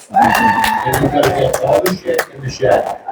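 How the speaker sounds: high, slow, strained complaint; mock exasperated rant. New Englander complains about stuffing the shed. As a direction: squeeze it out like a fed-up homeowner.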